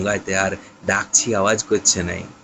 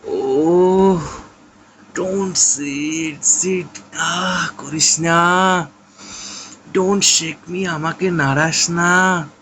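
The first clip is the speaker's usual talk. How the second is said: slow, drawn-out mock groan, higher; faking pain to his brother, slow hammed-up moaning; 6 semitones higher, much slower than usual. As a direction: moan it as if in pain. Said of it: "গোঙ্গাতে গোঙ্গাতে বলে উঠল".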